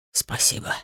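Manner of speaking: soft, voiceless whisper; whispered thanks. A narrator's reading. Tearful father thanking the artist on his knees, whispering. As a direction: whisper it in hushed gratitude. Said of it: "прошептал он".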